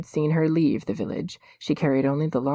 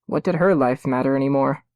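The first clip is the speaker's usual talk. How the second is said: usual speaking voice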